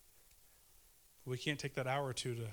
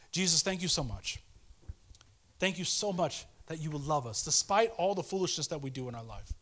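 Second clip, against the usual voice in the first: louder fervent closing prayer; 4 semitones higher, 5 dB louder than usual. Acted. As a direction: pray it out with earnest fervour. Closing prayer after "Let's pray.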